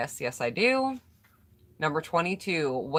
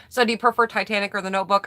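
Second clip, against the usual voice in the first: rattled off fast and a bit louder; quick recap; 4 semitones higher, 5 dB louder, faster than usual. Shown as a demonstration. Re-asking the question for a viewer who just joined, quick. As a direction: zip through the question again. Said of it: "questions again real quick".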